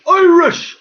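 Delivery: loud, high burst on one word; emphatic exclamation. Real, not acted. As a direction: cry it out with indignant force. Riled exclamation about being Irish.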